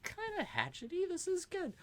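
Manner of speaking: high, goofy self-satisfied voice; mock proud of a makeshift axe; lightly hammed-up delight; pleased with herself. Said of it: "I'm like".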